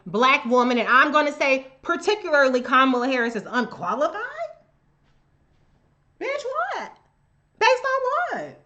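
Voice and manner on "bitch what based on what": high, mocking disbelief; shrill sarcastic disbelief; incredulous squeal. Real, not acted. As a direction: screech it like you can't believe they said that. Incredulous reaction to calling Kamala unqualified.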